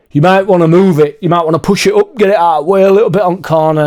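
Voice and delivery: lightly voiced pushy neighbour, slightly rushed; mock of a grumpy man ordering him to move the car. Imitating the annoyed neighbour.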